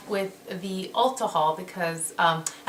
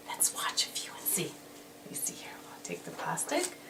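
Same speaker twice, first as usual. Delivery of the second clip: quiet voiceless whisper; 2 semitones lower, 11 dB quieter than usual. Real, not acted. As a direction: murmur to yourself while working. Whispering while swatching.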